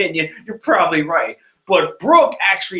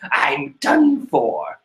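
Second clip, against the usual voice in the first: mock dread; 8 semitones higher, much slower than usual. Perospero's dread.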